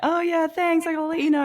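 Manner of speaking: mock polite. Mock polite brush-off of help.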